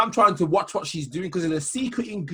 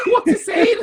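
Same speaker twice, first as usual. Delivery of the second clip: much higher, slow laughing shout; laughing disbelief; 13 semitones higher, slower than usual. Real, not acted. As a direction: crack up at the absurd claim. Friend cracks up in disbelief.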